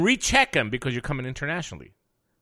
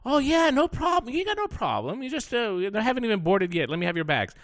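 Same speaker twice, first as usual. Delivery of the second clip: high, bright chirpy reassurance; cheerful counter agent; 7 semitones higher than usual. Retold or imitated. Counter agent reassuring the rushed traveller, chirpy and high. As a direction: sing it out like a breezy clerk.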